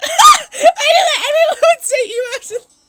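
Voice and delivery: very high, loud shrieks through laughter; laughing screams. Real, not acted. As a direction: scream it while laughing from embarrassment. Embarrassed, laughing after spicy voice.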